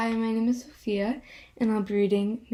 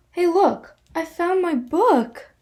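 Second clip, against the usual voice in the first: delighted discovery; 6 semitones higher, 4 dB louder than usual. Acted. Finds lost book.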